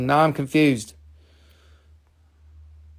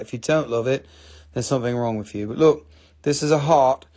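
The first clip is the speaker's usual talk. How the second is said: ordinary talk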